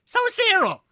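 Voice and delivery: very high, loud character voice; storyteller character turning to the next teller. Pretend. Game character asks next player.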